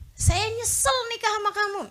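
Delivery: hammed-up regretful husband; husband complaining to his wife, imitated; mock husband. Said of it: "Gitu kan".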